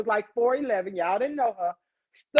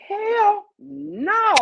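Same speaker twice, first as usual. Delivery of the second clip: mock yell; 9 semitones higher, 4 dB louder than usual. Teen refusing aunt in fight.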